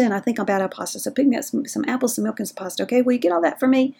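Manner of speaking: rapid run-on errand list; talking too fast. Shown as a demonstration. Host showing caregivers how confusing hurried grocery requests sound, rapid rattle. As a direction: rattle it off without pauses, far too fast. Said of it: "we just talk too dang fast".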